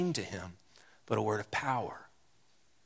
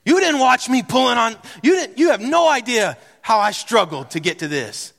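exasperated retort; 10 semitones higher, 6 dB louder than usual. Retold or imitated. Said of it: "what I wanna say is".

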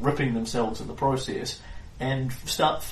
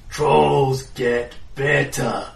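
slow dim troll voice; 4 dB louder, much slower than usual. Acted. GM voices two-headed troll.

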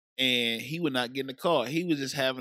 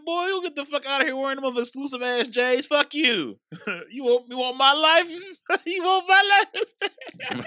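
high, loud mock scolding breaking into laughter; laughing mock scolding; 13 semitones higher, 4 dB louder than usual. Cousin retelling his jab at the sulking boy, laughing mock scolding. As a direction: tease him, laughing harder each line.

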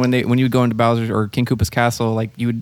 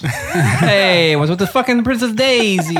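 put-on Sopranos accent; 7 semitones higher, much slower than usual. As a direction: ham up a Sopranos wiseguy, laughing. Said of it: "Now, now do it in The Sopranos".

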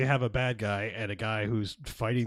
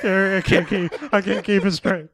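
much higher and louder, hammed-up fright through a laugh; mock panicked wife; 11 semitones higher, 12 dB louder than usual. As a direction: squeal the wife's fake panic. Mocking the wife's panic.

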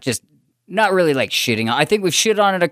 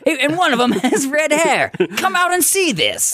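carnival barker; 11 semitones higher than usual. Pretend. Mock carnival barker pitch.

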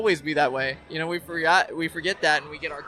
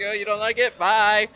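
higher-pitched sassy send-off, loud on the last word; mock dismissive brush-off; 6 semitones higher, 5 dB louder than usual. Replying to people who disapprove, mock dismissive brush-off. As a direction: wave them off breezily.

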